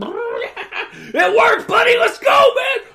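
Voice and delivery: very high, loud triumphant mock shout; excited Tyrese. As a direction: cheer it like a kid who pulled off a stunt. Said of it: "Tyrese is like".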